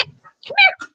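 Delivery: high sing-song call to the pet; high dog call. Real, not acted. Calling the dog Sage.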